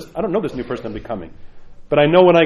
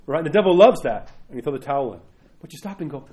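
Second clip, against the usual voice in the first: ordinary delivery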